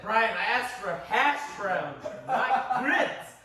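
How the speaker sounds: retold yell. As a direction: yell the complaint at a friend, half laughing, landing hard on the last word. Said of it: "I literally yelled at him".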